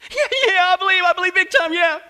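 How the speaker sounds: high, loud, eager panic; frantic assurance. Acted. Tourist swears belief to the voice above, frantic. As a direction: shout desperate agreement. Desperate tourist answers the heavenly voice.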